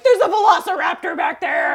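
high, loud, laughing fake alarm; mock panic. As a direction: yell a warning while laughing. Mock panic fleeing a dinosaur.

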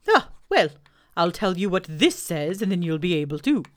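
Owl voice. Acted. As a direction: boom it out like a pompous owl. Owl bluffing.

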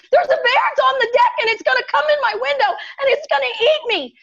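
put-on high, frantic child pleading with his mom; panicked. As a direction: blurt it fast in terror.

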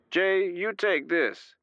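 high, slow, voiced over the speaker; slow orders through the intercom. A narrator's reading. Stubbs speaking to Jay over the intercom, slow. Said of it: "Stubbs said over the speaker".

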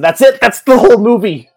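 high, loud disbelieving outburst; exasperated exclaim. Real, not acted. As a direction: throw up your hands, that's it. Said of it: "exasperated summing up".

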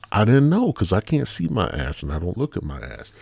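usual speaking voice

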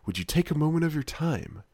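a hint of a gallant hero, overly polite, trailing off; lightly mock a courtly knight's plea; over-romanticized hero. Player mocking the hero asking a girl for help, lightly gallant. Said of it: "is really over-romanticized".

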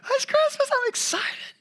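very high squeal; high-pitched childlike voice; excited child. Retold or imitated. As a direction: gush like a thrilled kid. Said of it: "I'm like".

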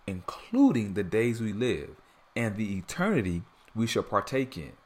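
ordinary talk, nothing marked

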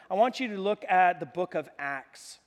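ordinary delivery

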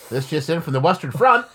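put-on loud high old newsreel announcer. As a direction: announce it like wartime radio.

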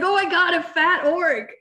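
gleeful gasp at the chunky orc; excited disbelief. Retold or imitated. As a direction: blurt it out like you can't believe it. Retelling her reaction to the orc.

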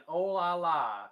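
slow, loud mock French "oh la la"; hammed-up touristy French; French accent. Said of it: "I was going up and down France saying".

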